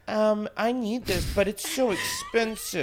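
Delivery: put-on high, slow, soft pleading baby talk; sugar baby voice. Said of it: "like, my sugar baby voice".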